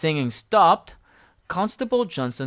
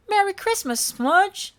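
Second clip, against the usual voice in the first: put-on much higher, bright childlike voice; 4 dB louder than usual. A narrator's reading. Elmo answering Smudge, high and perky. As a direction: chirp it like a cheerful little monster.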